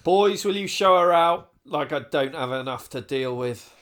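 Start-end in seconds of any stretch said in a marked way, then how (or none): 0.0-1.5 louder, commanding the staff; loud order. Acted. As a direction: bark it at the boys.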